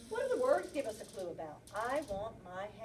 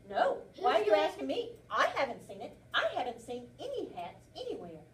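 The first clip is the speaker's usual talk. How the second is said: louder, protesting rabbit; defensive rabbit; 3 semitones higher, 7 dB louder than usual. Acted. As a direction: deny it too hard, guilty. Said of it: "Think about what he says".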